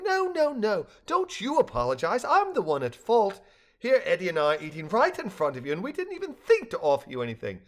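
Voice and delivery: high, insistent fussing hostess; exclaiming. A narrator's reading. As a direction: protest emphatically, starting very high. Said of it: "Lizzie exclaimed".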